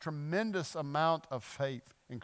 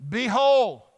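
high, loud healing command; commanding shout; 6 semitones higher, 11 dB louder than usual. Retold or imitated. Jesus commands the leper to be healed, commanding shout. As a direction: proclaim it with full authority.

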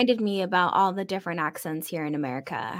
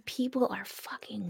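whispered aside; 14 dB quieter, slower than usual. Real, not acted.

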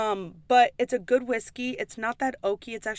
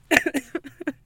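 laugh; 6 semitones higher, 6 dB louder than usual. Real, not acted. Laughing at own story.

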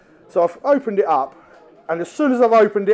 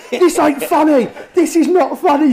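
angry; 9 semitones higher, 5 dB louder than usual. Retold or imitated. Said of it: "he was really pissed off".

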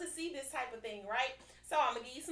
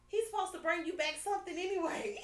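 half-shouted through laughter, pitched up; laughing retort; 6 semitones higher, 3 dB louder, faster than usual. Retold or imitated. Telling her man he owes her food, laughing loudly. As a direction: tease him while cracking up. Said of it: "And you say".

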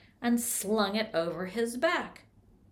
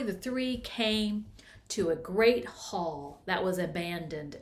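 plain reading voice

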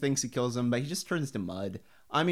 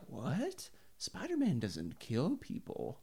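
shocked aunt; 3 semitones higher, 8 dB quieter, slower than usual. Retold or imitated. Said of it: "Aunt May is like".

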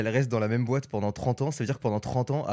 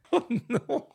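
laughing dismay; 11 semitones higher than usual. Real, not acted. Reacts to awkward story laughing.

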